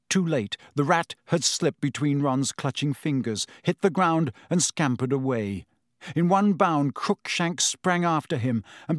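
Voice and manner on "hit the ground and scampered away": usual speaking voice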